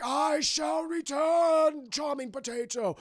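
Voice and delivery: slow, high, loud theatrical villain proclamation; drawn-out shouted villain voice; hammy villain. Retold or imitated. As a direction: proclaim it like a cartoon overlord. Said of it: "Cobra Commander's like".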